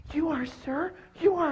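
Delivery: tiny, very high, soft voice; high-pitched timid underling; submissive snake. Acted. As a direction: squeak it meekly to placate a bully. Snake placates the lion.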